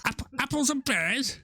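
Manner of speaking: high-pitched, exaggerated Cockney accent; mock Cockney. Mocking an actress's bad accent, hammed-up Cockney. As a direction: stumble into a silly put-on Cockney. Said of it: "can you do a Cockney accent?".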